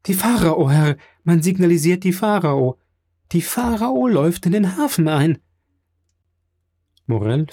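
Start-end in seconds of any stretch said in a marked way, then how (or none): 0.1-5.4 high, insistent reporting cry; excited announcement. A narrator's reading. Emmanuel telling Morel the ship arrives, excited insistence. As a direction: proclaim the news over his doubt.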